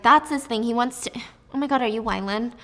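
usual speaking voice

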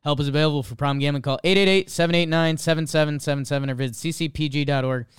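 rapid-fire fine print; fast legal disclaimer. Acted. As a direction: rattle off the small print.

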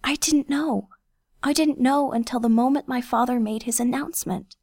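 high, helpless protest. A narrator's reading. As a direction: plead helplessly, near tears. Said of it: "The helplessness in her voice".

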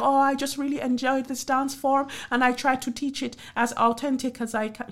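soft, imitated German accent; lightly put-on German accent, quietly earnest. Host quoting Magdalena on her dance class, German accent. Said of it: "that was my German accent of Magdalena".

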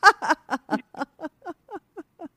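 long, high-pitched laugh; burst of laughter. Real, not acted. Podcast host told she sounds creepy, laughing hard. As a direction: crack up laughing.

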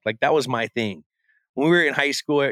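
usual speaking voice

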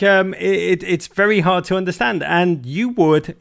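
usual speaking voice

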